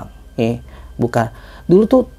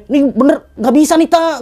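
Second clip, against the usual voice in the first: high, pleading call ahead; insisting call; 8 semitones higher than usual. Retold or imitated. Hiker telling friend his bag broke, high insisting call.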